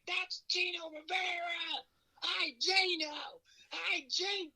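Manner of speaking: very high, drawn-out mock crowd shouts; excited fans calling. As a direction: imitate fans hollering from the stands. Acting out fans calling to him.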